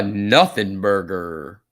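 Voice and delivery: loud, stretched-out words; drawn-out emphasis. Real, not acted. Streamer echoing nothing burger for lunch, drawn out. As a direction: draw out "nothing burger" with punch. Repeats viewer's phrase with relish.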